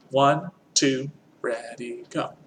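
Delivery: counting in, fading to near silence; soft count-in. Shown as a demonstration. Teacher counts in softly so the class can think the words. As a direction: count them in softly, then drop away. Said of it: "clap and think the words".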